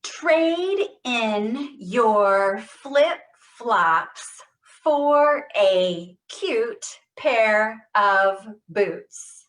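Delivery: very slow, loud, each word stressed; every word stressed. Shown as a demonstration. Therapist leading the group drill, each word stressed. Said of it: "Every word, give it stress".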